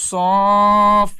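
সফ loud, vowel stretched before closing on ফ; lengthened vowel. Shown as a demonstration. Teacher spelling out the syllable, সফ loud and drawn out. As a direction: hold the vowel in সফ. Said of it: "এক আলিফ টান".

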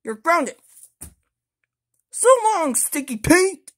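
loud playful scolding. Acted. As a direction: punish him loudly. Toy scolds another toy.

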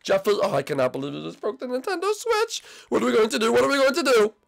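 high-pitched, fretting lament; distraught. Acted. Junior despairs to Jeffy over the Switch, distraught. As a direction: wail in dismay, pitched well up.

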